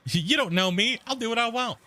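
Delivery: put-on high, sassy teen girl voice; Cash Me Outside girl. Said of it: "That Cash Me Outside girl".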